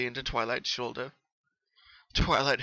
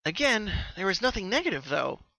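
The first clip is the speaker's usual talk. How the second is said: higher, louder bubbly pony voice; Pinky, subdued; 8 semitones higher, 5 dB louder than usual. Acted. Pinky telling Twilight when the dreams began. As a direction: explain it eagerly, a little worn.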